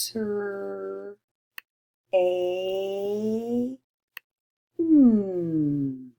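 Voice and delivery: slow, separate sounds like a lightly voiced robot; a hint of a robot, segmenting slowly; robot-like sounding out. Shown as a demonstration. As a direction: sound the word out like a robot, one piece at a time. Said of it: "like a robot".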